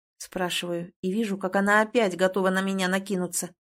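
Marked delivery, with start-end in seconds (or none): none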